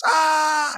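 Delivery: high, short imitated scream; mock scream. Retelling the Haunted Mansion ghost scream to the audience, a high mock scream. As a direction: yelp it like the ride ghost. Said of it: "and she goes".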